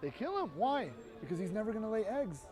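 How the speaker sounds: ordinary talk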